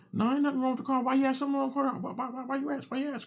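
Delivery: high, too-casual denial; forced calm. Retold or imitated. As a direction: play it cool and fail. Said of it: "'Cause I'm trying to be calm".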